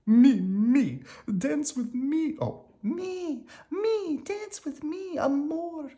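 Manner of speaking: very high, slow, swooning chase cries; ladies crying out. A narrator's reading. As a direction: coo and call after him like lovesick admirers. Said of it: "cried the ladies close behind".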